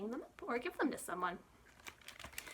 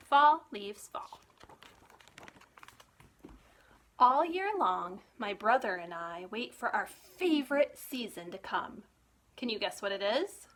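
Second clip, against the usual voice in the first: bright, lively storybook reading; reading to children; 3 dB louder, slower than usual. Acted. Librarian reading a picture book to children, lively storytime voice. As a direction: read it like storytime for little ones.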